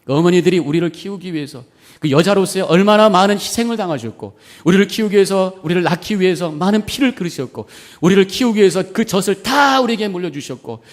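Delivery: loud, high, driving sermon climax; impassioned preaching. Real, not acted. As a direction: preach it out at full force. Preaches at full force.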